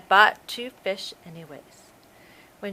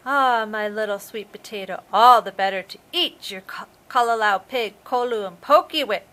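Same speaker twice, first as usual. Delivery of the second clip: loud triumphant shark bellow; shouted; 7 dB louder than usual. Acted. The shark shouting as he leaps out of bed. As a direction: roar it as the disguise drops. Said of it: "he shouted as he jumped out of bed".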